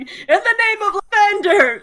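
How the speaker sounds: high, loud charging war cry; very loud heroic invocation; battle-cry shout. Acted. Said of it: "just shouting, very loudly".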